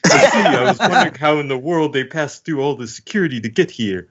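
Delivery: lightly mocking high imitation of Sanji. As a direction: parody his Sanji read. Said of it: "let me just imitate your voice".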